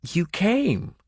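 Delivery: high, hushed disbelief; lady stunned her rescuer came; amazed. Acted. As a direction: breathe it out in wonder. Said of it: "she said in a tone of amazement".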